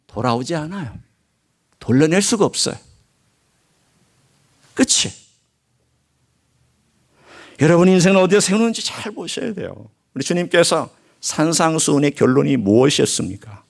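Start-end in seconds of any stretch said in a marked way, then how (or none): none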